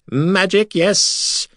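high excited alien cry; excited creature cry. A narrator's reading. Silver Sands delighted by the wizard's magic. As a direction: squeal it out with delight. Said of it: "they cried excitedly".

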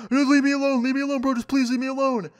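very high, imitated frantic scream; panicked screaming. Basketball player fleeing the friend, mock panicked screaming. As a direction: plead in a strained high wail, mocking his panic. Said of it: "this dude's screaming".

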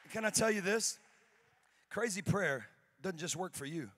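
ordinary talk, nothing marked

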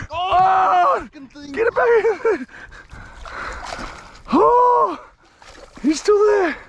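high-pitched, loud excited yelling; thrilled yelling. Real, not acted. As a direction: shriek with real delight as the fish hits. Fish striking, real excitement.

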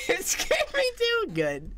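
very high, squeaky laughing words; drawn out through high laughter; real laughing squeal. Real, not acted. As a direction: squeal it while cracking up. Laughing after scaring self with scream.

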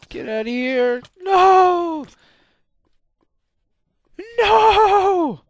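very loud, high screams of No; real panicked screaming. Real, not acted. Attacked, screaming repeatedly.

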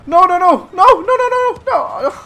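high, loud frantic screaming; panicked screaming. Real, not acted. Panicked in game.

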